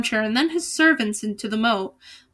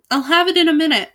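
fast, higher and louder in a rush; frantic cry; 5 semitones higher, 5 dB louder, faster than usual. A narrator's reading. Albert fumbles with the book, crying out frantically. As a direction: rattle it off in a flustered hurry. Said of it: "cried Albert".

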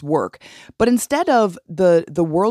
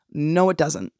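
usual speaking voice

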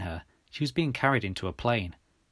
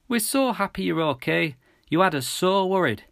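high, tearful mum voice; emotional mother, raised voice; sniffling mum; 7 semitones higher, 4 dB louder than usual. A narrator's reading. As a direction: fret over your child, pitched up. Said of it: "sniffed Mum, tears running down her face".

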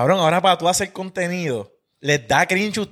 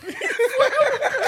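high laugh. Real, not acted. Laughing at absurd retold video.

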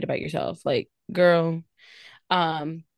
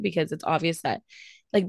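ordinary talk, nothing marked

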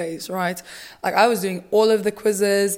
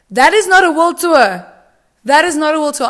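louder, higher, indignant declaration; ranting outburst; 6 semitones higher, 7 dB louder than usual. Real, not acted. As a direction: protest it like an outraged fan. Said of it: "That's my little outbreak".

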